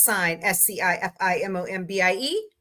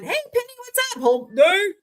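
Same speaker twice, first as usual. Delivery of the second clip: high, sing-song hello to a viewer; bright greeting; 11 semitones higher, 3 dB louder than usual. Real, not acted. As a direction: pop up high to greet Penny.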